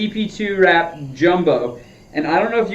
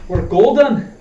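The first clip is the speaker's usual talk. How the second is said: a quick mock Scottish accent, a bit louder; playful Scottish accent; 3 semitones higher, 4 dB louder, slower than usual. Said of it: "speaking with a Scottish accent all the time".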